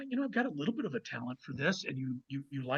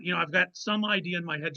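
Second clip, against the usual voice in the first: ordinary talk, nothing marked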